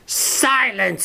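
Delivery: high, piercing witch queen shriek; witch queen scream. A narrator's reading. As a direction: shriek to silence the hall. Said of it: "screamed the witch queen".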